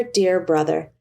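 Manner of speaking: plain reading voice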